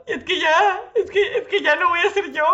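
high, tearful quote with a catch of crying; retold crying. Sister upset about dyeing her hair, crying. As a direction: voice the sister close to tears. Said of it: "Y empezó a llorar".

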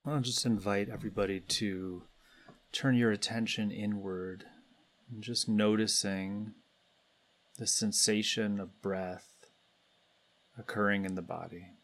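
calm, unhurried guidance with long pauses between phrases; guided meditation. Real, not acted. Meditation leader guiding everybody to turn attention inward, calm and spacious. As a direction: lead the group gently inward, leaving space after each phrase.

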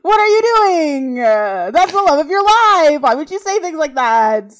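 loud, high exasperated telling-off; outraged scolding. Real, not acted. Scolding the TV character over his line, loud and high. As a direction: scold him like he just ruined everything.

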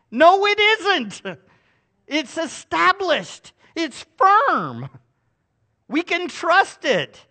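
loud, rising sermon proclamation; booming preacher's declaration; preaching at full force. Acted. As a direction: proclaim the Lord's authority from the pulpit. Preacher affirms Scripture emphatically.

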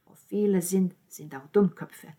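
ordinary delivery, nothing marked

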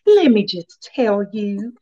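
slow Southern drawl, drawn out; Southern grandmother. Retold or imitated. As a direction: imitate a Southern grandmother, taking it slowly. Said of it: "with her Southern accent".

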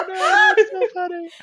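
high, loud laughing reply; cracking up at the mom story. Real, not acted. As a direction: squeal it through laughter.

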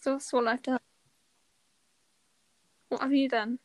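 ordinary talk, nothing marked

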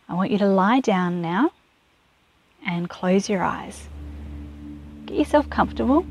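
gentle guided relaxation over music; guided meditation. Acted. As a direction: ease the listener into calm, softly. Leads a meditation.

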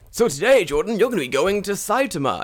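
high, loud mock announcement; company boss. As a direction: imitate an over-eager employer giving orders. Acting out the company's bosses.

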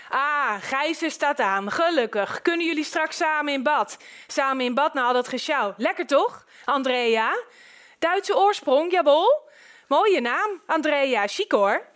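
chummy man making himself at home; overbearing jovial neighbour. Acted. Neighbour inspects the couple's new house and flatters Andrea, jovial.

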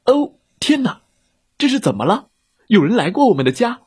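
high, alarmed exclamation; shocked bear. Acted. Bears discovering an intruder at home, high and shocked.

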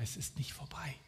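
a secret hint, barely voiced; gentle whisper. Jesus whispering comfort to his disciples. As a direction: whisper es ist nicht vorbei softly.

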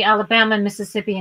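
ordinary talk, nothing marked

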